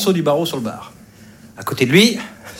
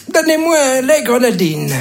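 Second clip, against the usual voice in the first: put-on high, thin little old man; frail little old man; 7 semitones higher than usual. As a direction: play a puny old customer ordering. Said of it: "le vieux, il est tout fluet". (petite voix fluette de vieillard)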